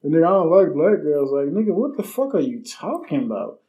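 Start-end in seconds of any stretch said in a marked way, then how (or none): 0.0-1.3 raised, louder mock quote of a dismissive guy.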